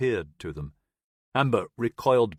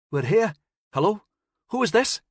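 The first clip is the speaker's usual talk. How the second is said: higher, louder, eager reply; eager answer; 11 semitones higher, 5 dB louder than usual. Acted. Ship crew answering the radio, eager and louder. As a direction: jump on the radio call.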